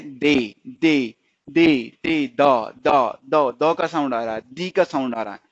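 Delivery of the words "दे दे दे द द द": दे and द drawn out slowly, each one separate and loud; careful sounding out. Shown as a demonstration. Teacher showing learners the sound, दे and द pronounced slowly and distinctly. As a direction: sound out दे, then द, slowly and firmly. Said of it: "ध्यान से प्रोनाउंसिएट कीजिए".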